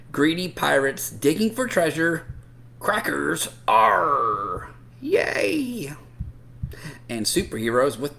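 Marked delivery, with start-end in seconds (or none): 2.8-5.9 high, cheering cartoon cry; squeaky cartoon voice. Reader voicing a drawn pirate cheering, squeaky. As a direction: squeal it for the picture. Reading drawing speech bubbles.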